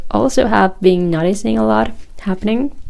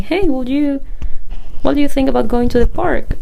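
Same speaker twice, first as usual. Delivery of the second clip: higher-pitched, quick, approval-seeking ask; mock roundabout asking, pitched up and hurried; tentative question; 6 semitones higher than usual. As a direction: imitate someone floating an idea as a timid question. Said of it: "they try to say it as a question".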